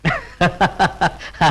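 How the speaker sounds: scornful chuckling laugh; mocking laugh. Man laughing at the woman's reason for writing, mocking. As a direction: laugh off her claim with derision.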